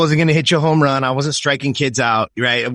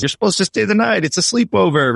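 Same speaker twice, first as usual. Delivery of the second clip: mom voiced higher, lightly mocking; a hint of a put-upon mother, pitched up; mom's voice; 8 semitones higher than usual. Mom telling her son on the phone he must stay: mildly mock-exasperated. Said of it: "And she's".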